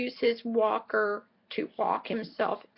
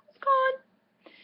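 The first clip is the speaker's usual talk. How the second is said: mock wail. Tea ran out, mock wail.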